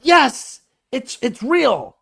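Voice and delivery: high, emphatic declaration; mock convinced believer. Someone falls for the psychic act, mock convinced excitement. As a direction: proclaim it in high-pitched, drawn-out conviction.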